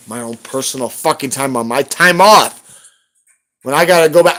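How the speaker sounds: normal talking voice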